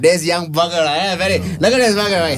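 hearty Eurasian uncle impersonation; Eurasian uncle accent. Retold or imitated. Uncle hails a young chap, in a Eurasian uncle accent. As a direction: greet him as a chummy Eurasian uncle. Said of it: "That is a Eurasian uncle accent".

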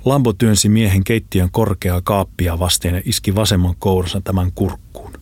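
ordinary delivery

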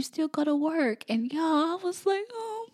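real tearful rise, choking up; about to cry. Real, not acted. Mother moved by daughter's words, about to cry. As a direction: voice climbing high, welling up. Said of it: "I'm about to cry".

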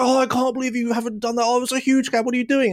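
imitated instructor, high and exasperated; mock scolding. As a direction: nag in a high-pitched put-on voice. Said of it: "when scolding me".

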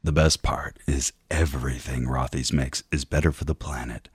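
low, husky perfume-ad voice; sultry low voice. Acted. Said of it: "yours was lower".

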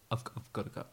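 hushed, far-off whisper; whispered exit. Retold or imitated. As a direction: whisper it while slipping away. Repeated as Elon fleeing.

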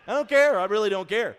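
high, loud dad shutting it down; firm refusal. Retold or imitated. Dad telling son he doesn't care, loud flat refusal. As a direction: slam the door on the argument.

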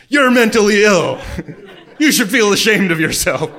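loud, high-pitched mock scolding; mock ridicule. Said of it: "Where he's being ridiculed".

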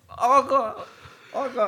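put-on high, loud excited kid; eager kid voice. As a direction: jump in like a thrilled kid.

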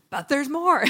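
upbeat pitchman announcing; infomercial voice. Pretend. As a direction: sell it like a TV ad. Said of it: "sound like an infomercial".

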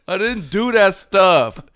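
high, loud, drawn-out mock denial; slow, hammed-up protest of innocence. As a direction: imitate an interviewee protesting, stretching every word.

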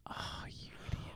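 whispered under the breath. Retold or imitated. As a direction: whisper it at the screen. Viewer reacting under their breath.

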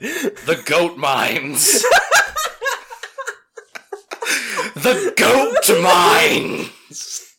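high, slow, wailing laugh; cracked Job voice. Retold or imitated. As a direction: wail it out half laughing, half crying. Job answer, crying laugh.

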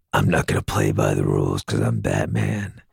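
low, rasping mock Batman line; gravelly Batman voice. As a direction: drop into the deep Batman growl. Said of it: "Does he talk like that?".

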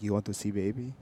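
put-on "You want to see baby?" in a throaty Eastern European accent. Host quotes the Ukrainian neighbor in a throaty Eastern European accent. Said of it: "That was pretty good".

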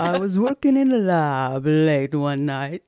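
slow, spooky horror-movie voice; slow Karloff-style creepy delivery; Boris Karloff impression. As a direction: drawl it out like an old horror host. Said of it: "in the Boris Karloff voice".

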